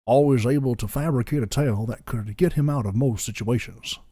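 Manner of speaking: put-on Southern storyteller with a lilting Georgian accent. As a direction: narrate a backstory in a lazy Georgian accent. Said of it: "I'll get to Georgian".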